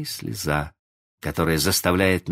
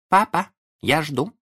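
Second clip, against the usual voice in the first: put-on high, slow, pointed child's voice; cold remark; 11 semitones higher, 3 dB louder than usual. A narrator's reading. Small son waiting on his father, coolly pointed. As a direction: wait coolly, spacing the words out. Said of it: "холодно заметил Майкл".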